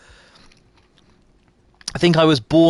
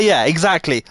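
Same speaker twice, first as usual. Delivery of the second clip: ordinary talk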